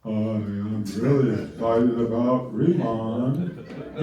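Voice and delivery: low, slow, deadpan excited claim; flat fake excitement. Shown as a demonstration. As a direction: say you're excited with no life in it. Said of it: "If you're like".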